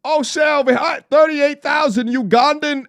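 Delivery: mocking tease. Real, not acted. Mocking donor amount.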